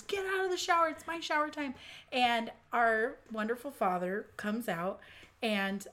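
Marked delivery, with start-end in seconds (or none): 0.0-1.7 retold yelling. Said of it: "I'm yelling at the top of my lungs".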